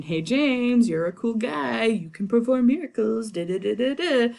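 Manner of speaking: butter up the king, breezy; flattering James. Retold or imitated. Shakespeare fawning over King James, sing-song mock praise. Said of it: "Shakespeare saying".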